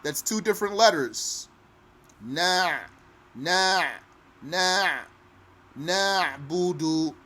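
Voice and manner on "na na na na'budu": "na" repeated then "na'budu" with the ayn from the throat; noon then ayn. Shown as a demonstration. Teacher drilling the noon before the ayn. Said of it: "noon, na, and then an ayn".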